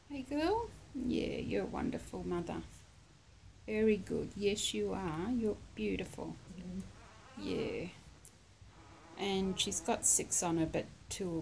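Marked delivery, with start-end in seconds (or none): 0.1-2.6 soothing a scared pet. Real, not acted. Talking to the nursing mother dog. 3.7-4.3 gentle high praise to a dog; soothing a scared pet. Real, not acted. 4.4-7.9 soothing a scared pet. Real, not acted. Talking to the nursing mother dog.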